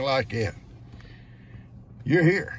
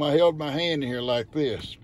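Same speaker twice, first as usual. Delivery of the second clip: normal talking voice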